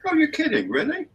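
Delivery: very high-pitched, put-on astonishment; mock disbelief. Co-host told that cocktail olives aren't fresh fruit, mock disbelief. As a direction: feign shock at the news.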